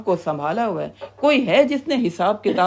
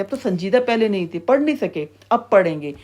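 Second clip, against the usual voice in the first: normal talking voice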